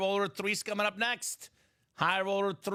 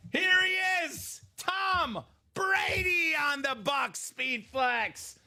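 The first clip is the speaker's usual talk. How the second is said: big, drawn-out high announcer call; excited reveal; 7 semitones higher, slower than usual. Real, not acted. Host reveals the big pull to viewers, excited announcement. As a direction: trumpet the big reveal, stretching the name.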